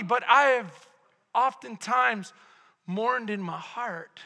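ordinary delivery

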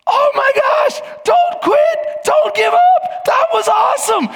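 very high, loud, excited cheering shout; cheering yell. Retold or imitated. Girl on the ski lift cheering on a fallen skier, high excited yell. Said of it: "She's yelling from the lift above".

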